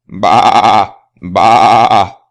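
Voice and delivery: a loud, held bleating baa; sheep bleat. Acted. Reading to children, the woolly sheep bleats loudly. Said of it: "bleats the sheep".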